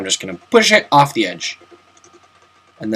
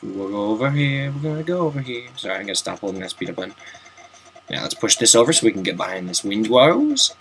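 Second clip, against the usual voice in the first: ordinary talk, nothing marked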